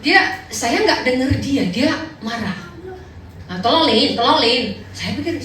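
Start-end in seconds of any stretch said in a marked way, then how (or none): 3.6-4.7 put-on Taiwanese accent. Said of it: "dia ngomong bahasa Taiwan gitu".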